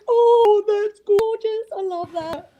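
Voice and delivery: high, slow, gushing coo. Retold or imitated. Wife imitating herself seeing old couples, high slow gushing coo. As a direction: swoon over the old couple holding hands. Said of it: "And I'm just like".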